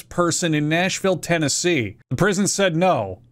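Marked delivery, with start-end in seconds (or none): none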